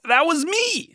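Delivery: a squeaky, smug take on a friend; Colin Jost impression. SNL friend at dinner claims credit in a squeaky mock voice. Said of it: "who was all".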